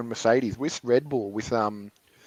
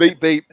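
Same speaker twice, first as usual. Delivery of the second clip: horn imitation; 4 semitones higher, 9 dB louder than usual.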